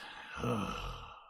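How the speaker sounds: long, low, tired exhale; weary sigh. Acted. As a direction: let the captain's patience drain out in a sigh. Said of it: "but he just goes".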